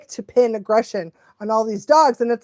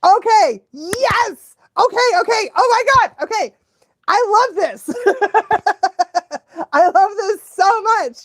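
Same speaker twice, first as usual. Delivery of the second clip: high, excited exclamations breaking into laughter; delighted gushing; 10 semitones higher, 4 dB louder than usual. Real, not acted. Watching a clip she loves, delighted and gushing. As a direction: gush with real delight, laughing.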